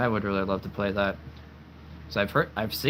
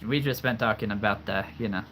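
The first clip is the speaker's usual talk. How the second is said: normal talking voice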